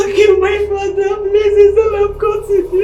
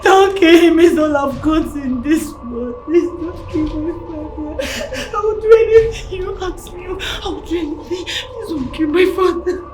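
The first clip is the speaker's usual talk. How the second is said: tearful pleading; 3 semitones lower than usual. Acted. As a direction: beg through tears for his life. Begs kidnapper on phone.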